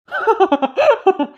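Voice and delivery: high falling laugh; officer laughing at the prisoner's empty threat; mocking laugh.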